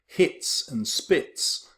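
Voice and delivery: slow, breathy hits and spits, the rhyme leaned on; internal rhyme read slowly. Shown as a demonstration. Teacher pointing out the half rhyme, drawn out. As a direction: let the its endings ring out. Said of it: "repetition of internal rhyme".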